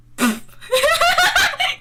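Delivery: high, loud laugh; explosive high cackle; loud laughing fit. Real, not acted. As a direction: burst out in high-pitched laughter. Laughs at own flat retort.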